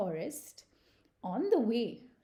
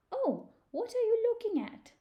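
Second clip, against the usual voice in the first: high-pitched, inquisitive little voice; curious squirrel; 13 semitones higher, 4 dB louder than usual. Acted. Squirrel asks turtle about his map, curious and high.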